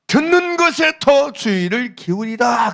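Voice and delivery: loud, measured scripture read. Acted. Reads scripture in sermon. (설교단에서 성경을 힘주어 낭독)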